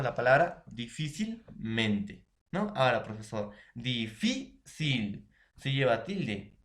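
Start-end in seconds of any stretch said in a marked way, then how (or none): 3.7-5.3 "difícil" very slow, di-FÍ-cil, stress on FÍ; stressed syllable-by-syllable. Shown as a demonstration. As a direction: break "difícil" into syllables, leaning on FÍ. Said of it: "la mayor fuerza de voz en la penúltima sílaba".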